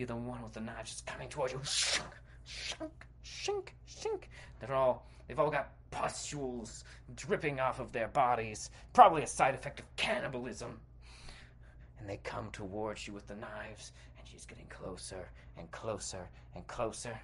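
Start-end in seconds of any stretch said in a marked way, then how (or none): 1.6-4.2 softly imitated blade scrapes; hissy, spaced-out sharpening sound effect; knife noise. Knife-wielder coming towards you, quiet metallic scraping noises. 12.0-17.2 low, soft, creeping narration; hushed suspense. A narrator's reading. Cannibals close in with knives, hushed and ominous.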